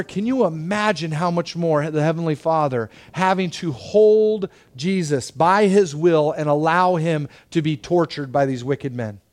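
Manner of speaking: ordinary talk, nothing marked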